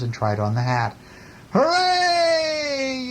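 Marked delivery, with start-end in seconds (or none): 1.5-3.1 high, long-held cheer; yelled cheer. A narrator's reading. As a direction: yell it joyfully, stretching the last vowel. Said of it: "yelled the bear".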